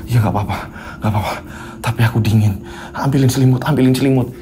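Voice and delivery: a hint of a feverish husband; lightly voiced weak sick man. Feverish Riko asks his wife for a blanket, low and weak.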